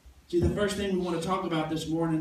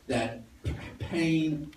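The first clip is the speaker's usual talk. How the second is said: ordinary talk, nothing marked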